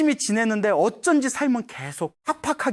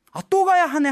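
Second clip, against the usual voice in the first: higher-pitched mock grumble of reluctance; 귀찮은 푸념; 10 semitones higher, 4 dB louder than usual. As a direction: act out a put-upon "not this chore" thought. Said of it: "하고 귀찮은 마음이 먼저 드는". (귀찮은 듯 높은 톤으로 투덜대는 흉내)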